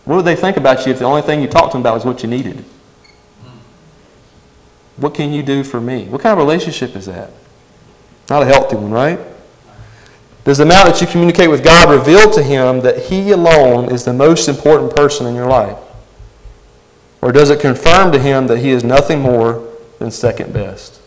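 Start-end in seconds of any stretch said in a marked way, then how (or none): none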